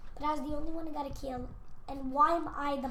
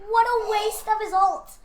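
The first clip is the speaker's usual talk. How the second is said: excited; 6 semitones higher, 7 dB louder than usual. Real, not acted. Repeated exclamation.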